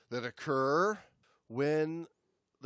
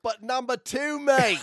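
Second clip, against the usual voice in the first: higher, louder hammed-up Aussie voice; mock Australian accent; 11 semitones higher, 10 dB louder than usual. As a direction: lay on a cartoon Aussie twang. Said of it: "with our accents".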